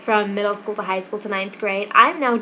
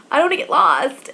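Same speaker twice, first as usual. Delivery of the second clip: higher, louder imitated whine; mock whine; 6 semitones higher, 4 dB louder than usual. Teased freshman defending her school map, mock whine. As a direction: plead it like an embarrassed freshman.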